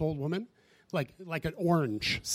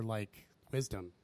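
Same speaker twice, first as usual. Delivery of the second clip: annoyed sarcasm; 7 semitones lower, 10 dB quieter, slower than usual. Retold or imitated. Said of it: "I'm super annoyed".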